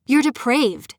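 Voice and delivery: sharp furious outburst at her captor; snapped. Acted. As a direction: spit it at him. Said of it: "I snapped".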